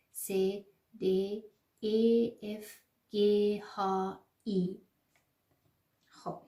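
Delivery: ordinary delivery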